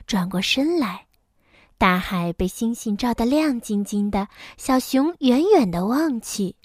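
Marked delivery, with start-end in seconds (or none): none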